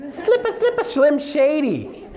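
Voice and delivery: high laughing rap imitation, slow on the name. Jokingly raps his intro to the class. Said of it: "I am a rapper".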